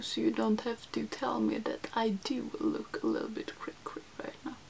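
whispered, fading almost to nothing; whispered joke. Real, not acted. Vlogger whispering a joke about her own crazy makeup. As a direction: hush it like a secret joke. Joking aside to viewers.

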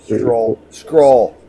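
rising from plain to a loud cry; building excitement. Acted. Hyping the smooth scroll.

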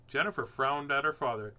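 ordinary delivery

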